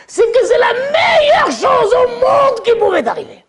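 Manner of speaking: high, slow, loud cry; high impassioned plea; impassioned proclamation. Acted. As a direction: cry it out with full conviction. Hachem reassures son.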